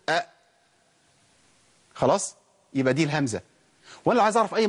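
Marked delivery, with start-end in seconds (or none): 0.1-0.3 "أ،" as a vowel cut off by a glottal stop; short, checked "أ،" ending on a clean catch; hamza sakina demo. Shown as a demonstration. Teacher showing students how to sound a still hamza, short stopped "أ،". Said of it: "أقول كده".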